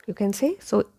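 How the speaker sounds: ordinary delivery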